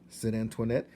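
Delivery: ordinary delivery, nothing marked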